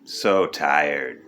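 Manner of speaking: deep, processed voice-changer sound; weary words through a deep voice changer; filtered voice. Acted. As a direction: talk through a low voice filter. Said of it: "Here's some more of our new X-Men filter".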